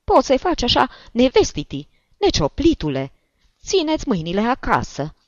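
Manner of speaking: put-on indignant old woman, high and loud; high-pitched outraged reproach; offended old woman. A narrator's reading. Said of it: "se supără bătrâna".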